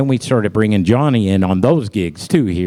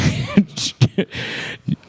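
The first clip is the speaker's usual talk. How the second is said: breathy laugh; 8 semitones higher, 5 dB quieter than usual. Real, not acted. Laugh between lines.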